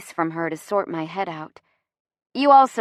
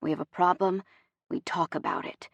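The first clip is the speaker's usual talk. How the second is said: gentle, measured, dropping softer; 2 semitones lower, 8 dB quieter than usual. A narrator's reading. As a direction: lay it down softly. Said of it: "his voice soft".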